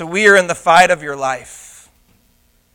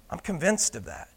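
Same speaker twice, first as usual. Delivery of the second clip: usual speaking voice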